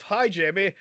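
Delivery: usual speaking voice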